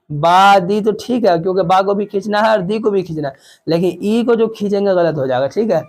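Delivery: ordinary talk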